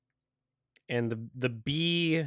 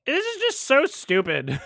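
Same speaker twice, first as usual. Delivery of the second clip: exasperated disbelief; 13 semitones higher, 3 dB louder than usual. Real, not acted. Reacting to dumb movie scientists.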